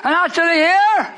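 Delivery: rising preacher cadence, call and answer; sermon call for amens; preaching call. Acted. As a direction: preach it to the congregation.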